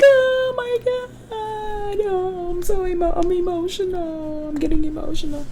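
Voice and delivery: squeaky hammed-up teary voice; mock emotional. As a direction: ham up someone getting choked up. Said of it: "Robin's like".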